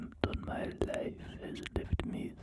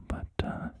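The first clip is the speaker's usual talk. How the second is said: ordinary talk, nothing marked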